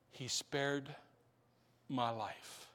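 very soft, low, slow; hushed testimony. Real, not acted. Preacher testifying that he was spared, hushed. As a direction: breathe it out in awe.

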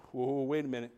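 low, soft, fading to very quiet; hushed promise. Acted. Jesus promises the glory of God, softly.

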